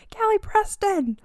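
high, loud shout heard by the neighbors. Shown as a demonstration. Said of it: "me shouting".

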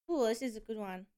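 usual speaking voice

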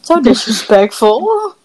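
slow, drawn-out mock indignation; mock offence. Retelling the comeback to Andrew's dig, mock offence. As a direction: draw it out as if playfully affronted.

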